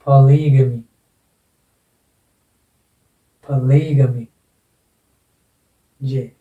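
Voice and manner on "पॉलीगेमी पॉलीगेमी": "Polygamy" as puh-LEE-guh-mee, falling after "ली"; stress on LI. Shown as a demonstration. Teacher repeats the word accent, stress on LI. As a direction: hit "ली" hard in "polygamy". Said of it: "इसमें word accent यहाँ पर".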